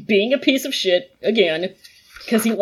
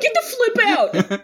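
high-pitched, laughing fake rage; mock furious outburst; 11 semitones higher than usual. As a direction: play angry with a silly clean curse. Imagining him cursing with soft swears.

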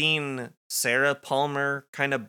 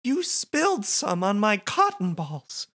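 Nadine voice; 11 semitones higher than usual. Acted. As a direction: build up to a high excited reveal. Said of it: "she says".

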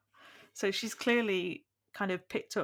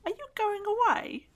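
put-on very high, squeaky small child calling up the stairs; eager little boy's voice far above the narrator's own; weird little boy voice; 5 dB louder than usual. A narrator's reading. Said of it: "giving Ronnie a really weird voice".

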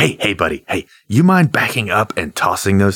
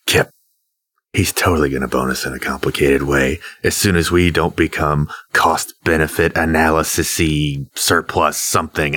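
ordinary talk

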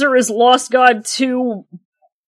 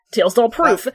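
ordinary talk, nothing marked

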